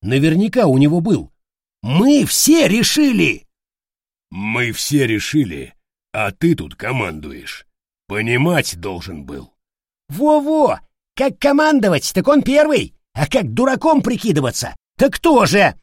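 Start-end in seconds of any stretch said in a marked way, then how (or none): 1.8-3.5 loud, high, drawn-out protest; shouted insistence. Acted. Cornered figure insists everyone decided, shouting. 4.3-9.5 low rebuke. Acted. Soldier turns the blame on the commander, reproachful. Other figure answers in low voice. 10.1-15.8 high, loud, agitated accusation; indignant jeer. Acted. Soldier backs up the accusation against leader, indignant.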